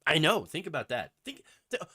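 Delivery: ordinary delivery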